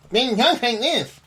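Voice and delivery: very high mimicked voice, Mike Tyson style; Mark Levin. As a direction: do the radio host's high-pitched voice. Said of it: "sort of that Mike Tyson kind of thing".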